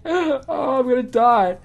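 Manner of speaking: usual speaking voice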